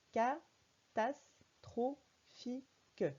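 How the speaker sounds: Catastrophique sounded out very slowly, every syllable full; each syllable of Catastrophique kept separate. Shown as a demonstration. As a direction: read it the way it is written. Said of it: "on ne dit pas catastrophique".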